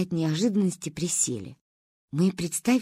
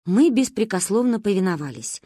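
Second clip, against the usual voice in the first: ordinary delivery, nothing marked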